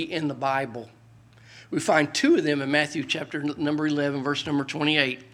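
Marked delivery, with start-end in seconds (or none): none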